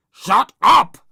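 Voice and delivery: high, very loud angry shout; Rude Ralph shouting. A narrator's reading. Boy silencing scared friend, shouting. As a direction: yell both words hard. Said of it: "shouted rude Ralph".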